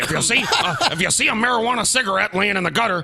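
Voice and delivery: high, goofy McGruff crime dog voice; hammy cartoon dog PSA voice. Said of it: "his Scotty impersonation sound like a cartoon dog".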